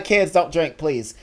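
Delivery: normal talking voice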